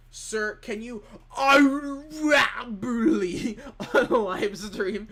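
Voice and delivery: reading it out while cracking up with laughter; reading chat while laughing. Real, not acted. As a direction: laugh through the words, high and slow. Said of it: "reading a chat message".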